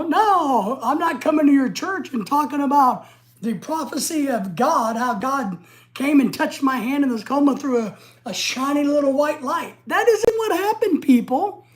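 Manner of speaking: getting excited. Real, not acted. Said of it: "I'm getting excited".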